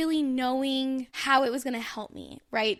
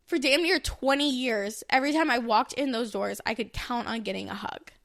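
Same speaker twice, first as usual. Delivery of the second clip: ordinary talk, nothing marked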